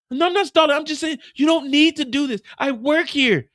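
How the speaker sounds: high, loud frantic denial; panicked protest. Acted. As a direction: talk fast to save yourself.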